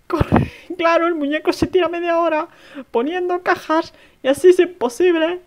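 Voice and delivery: loud high frantic rush; being chased. Real, not acted. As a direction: yell while fleeing.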